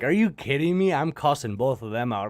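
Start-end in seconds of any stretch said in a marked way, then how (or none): 0.0-0.9 higher and louder, a replayed angry outburst; retold outrage. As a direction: throw it back at the friends in disbelief. Retelling cussing out friends.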